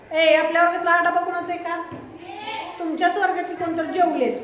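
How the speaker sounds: ordinary talk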